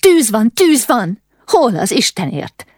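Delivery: high, loud, urgent calling out; alarmed cry of fire. A narrator's reading.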